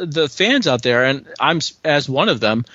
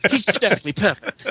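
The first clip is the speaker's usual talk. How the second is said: a hammed-up, badly faked British accent, slightly higher; terrible British accent; 4 semitones higher than usual. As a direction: put on a clumsy mock British accent for a laugh. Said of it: "That's a terrible British accent".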